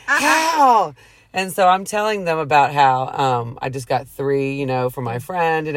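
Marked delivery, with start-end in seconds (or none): 0.1-0.9 high, loud mock shriek of disbelief; big, high-pitched astonished yell, acted out; retold excited screaming. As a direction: imitate a carful of kids yelling in shock. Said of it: "all of 'em started screaming".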